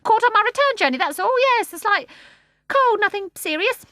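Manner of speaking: high, loud huffy bird; starling denying he cried, huffy; indignant starling. A narrator's reading. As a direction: deny it indignantly. Starling voiced.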